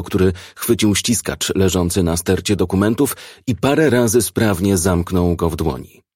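ordinary delivery

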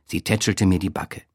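ordinary delivery, nothing marked

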